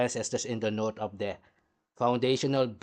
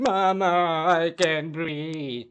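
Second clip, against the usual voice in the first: slow, strained mock gasping plea; a hammed-up, breathless plea for mother; mock dying plea; 3 semitones higher, 4 dB louder, slower than usual. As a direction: drag it out like a man choking. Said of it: "That's why he was saying".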